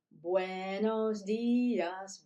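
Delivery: teacher modeling slowly; slow model greeting. Shown as a demonstration. Teacher modeling slow greeting for young learners. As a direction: stretch each syllable slowly for learners. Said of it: "Let's try it again".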